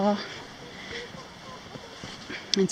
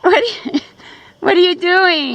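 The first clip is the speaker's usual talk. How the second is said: calling out; 6 semitones higher, 9 dB louder than usual. Real, not acted. Calling dog across canyon.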